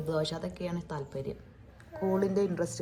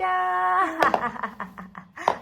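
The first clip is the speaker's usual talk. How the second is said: delighted laugh; 11 semitones higher, 4 dB louder than usual. Real, not acted.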